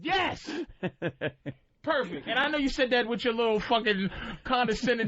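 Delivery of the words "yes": high delighted yes with a laugh; thrilled agreement; excited yes. Real, not acted. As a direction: burst out with it.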